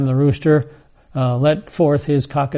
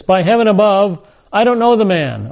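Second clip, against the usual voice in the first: higher-pitched, insistent denial; emphatic oath; 5 semitones higher than usual. Acted. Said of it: "he's trying to be emphatic".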